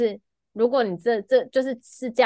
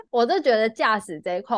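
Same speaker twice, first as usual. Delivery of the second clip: ordinary talk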